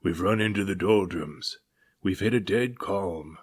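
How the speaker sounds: put-on old captain grumbles on becalmed deck; growling captain. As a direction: growl out your dismay. Captain voiced.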